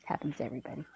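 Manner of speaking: soft unvoiced mock-consoling whisper. Whispering the consoling line to a friend, mock hush. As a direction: breathe it as a secret.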